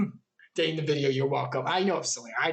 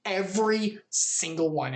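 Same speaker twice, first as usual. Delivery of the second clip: ordinary delivery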